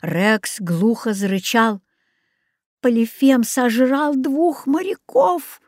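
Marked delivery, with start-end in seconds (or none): 2.8-5.6 horrified. A narrator's reading. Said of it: "ужаснулся я".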